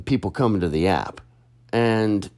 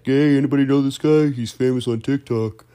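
louder, quick mock announcement to the room; joking buddy; 6 semitones higher, 3 dB louder than usual. Buddy teasing him to strangers in Vegas, joking call-out. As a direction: imitate a buddy calling out to strangers. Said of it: "my buddy was making jokes about me".

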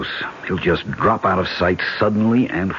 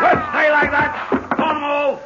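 tense shout. Acted. Captive shouting at the gunman to stay still. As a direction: shout it high and sharp.

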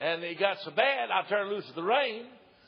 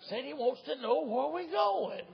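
slow, lightly voiced grumbling husband; 8 semitones higher, slower than usual. Husband relays to wife.

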